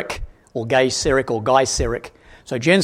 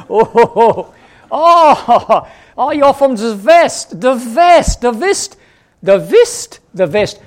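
put-on loud, high comic German accent; mock German accent; 7 semitones higher, 5 dB louder, slower than usual. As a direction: ham up German, stretching "West" with a V. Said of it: "Sorry about the German accent".

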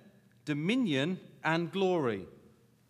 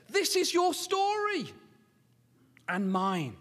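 high, ringing proclamation; emphatic sermon climax; impassioned preaching; 6 semitones higher, 5 dB louder than usual. Real, not acted. Preaches at full force.

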